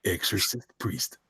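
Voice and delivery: slow, soft tagline voice; Boyette impression. Host redoing the tagline in a friend's voice, soft slow impression. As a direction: keep it hushed and deliberate. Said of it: "let me read it in Boyette's voice".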